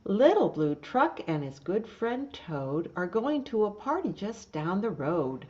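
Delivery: lively, loud storybook reading with animal voices; reading to children. Acted. Teacher reading Little Blue Truck's Halloween to the class, lively loud reading. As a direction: read it big and bright for little listeners. Reads picture book aloud.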